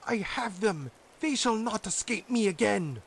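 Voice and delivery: high, loud gloating witch voice; mocking witch. A narrator's reading. Witch gloating as the children approach, high loud mocking voice. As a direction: gloat over your prey. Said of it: "said mockingly".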